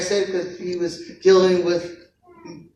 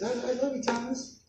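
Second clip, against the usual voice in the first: much higher and much softer, gentle and soothing; tender Jesus voice; 6 semitones higher, 9 dB quieter than usual. Acted. Jesus soothing the doubting Thomas, soft and high. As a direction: coax a grieving friend quietly, lifting the pitch. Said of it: "He says".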